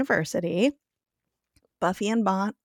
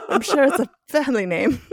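higher-pitched quote through laughter; laughing impression; 5 semitones higher, 4 dB louder than usual. Podcaster quoting Giles on a silly name, laughing. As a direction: barely get the quote out for laughing.

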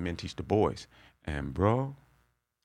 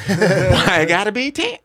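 much higher and louder, slow, through laughter; laughing mock whine; 9 semitones higher, 10 dB louder, slower than usual. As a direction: laugh while mimicking the complaint. Mocking women arguing, laughing.

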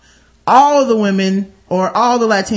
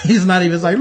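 ordinary talk, nothing marked

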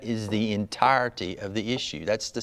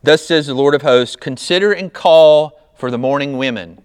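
loud, raised-pitch Bible reading; scripture reading; 4 semitones higher, 11 dB louder than usual. Real, not acted. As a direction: proclaim the verse forcefully to the congregation. Reads scripture aloud to congregation.

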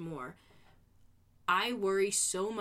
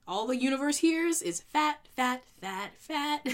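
emphatic; 7 semitones higher, 6 dB louder than usual. Real, not acted. Mocking universe hearing fat.

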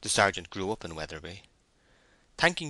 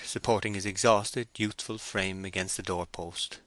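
usual speaking voice

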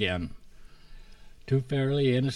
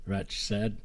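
ordinary delivery, nothing marked

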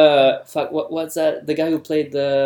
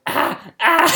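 high strained cries; Bill Burr doing Schwarzenegger; 11 semitones higher than usual. Acted. Comedian imitating Schwarzenegger with his maid, high mock cries. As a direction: cry out high in mock passion. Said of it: "his impression of Schwarzenegger sleeping with his".

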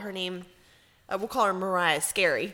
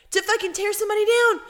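higher, louder and fast rant; 13 semitones higher, 7 dB louder, faster than usual. Real, not acted. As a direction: build to a fast, loud rant. Ranting about teasing.

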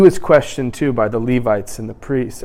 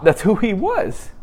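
usual speaking voice

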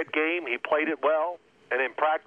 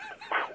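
quick high laugh burst; short burst of laughter; 7 dB quieter than usual. Retold or imitated. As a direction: let out one sharp laugh. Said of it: "And I just went".

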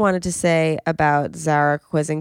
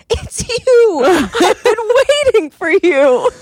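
high loud laughing delight; excited recognition; 8 dB louder, slower than usual. Retold or imitated. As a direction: burst out with gleeful recognition. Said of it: "And he goes".

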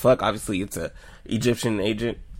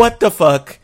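high, loud disbelief; shocked; 9 semitones higher, 8 dB louder, slower than usual. Retold or imitated. Viewer seeing feet put in glass, shocked. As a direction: blurt it out as a stunned viewer. Retelling shock at opening scene.

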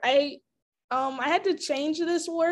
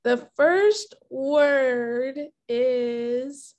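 very slow, drawn-out announcement; suspenseful reveal; much slower than usual. Real, not acted. Host revealing the challenge word to contestant, slow suspenseful build. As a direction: stretch each word before the reveal.

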